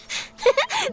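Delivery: short high giggle; gleeful chuckle. Acted. Reporter delighted by exclusive news, high giggle. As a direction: giggle with relish at a scoop.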